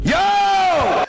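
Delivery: very high loud yo; excited yell. Real, not acted. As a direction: shout with delight at the clip. Excited outburst at clip.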